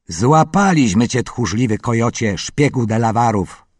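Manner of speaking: put-on boy chief taunting the prisoner; called out. A narrator's reading. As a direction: crow it over the captive. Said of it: "zawołał".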